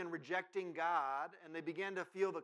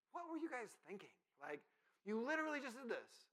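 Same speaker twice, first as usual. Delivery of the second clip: higher-pitched exasperated disbelief; incredulous; 6 semitones higher, 4 dB quieter than usual. Acted. Reader scolding the fickle Israelites, incredulous. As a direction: ask it as if you can't believe their foolishness.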